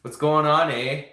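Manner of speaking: loud, playful Canadian "eh"; Canadian accent. Pretend. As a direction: ham up a Canadian accent on request. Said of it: "Can you say A for me, Delilah?".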